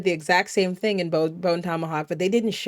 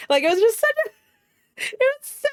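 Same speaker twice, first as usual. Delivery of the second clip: much higher, cracking with laughter; laughing while talking; 13 semitones higher, 3 dB louder, faster than usual. Real, not acted. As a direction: laugh it out through the words. Laughing at bad movie scene.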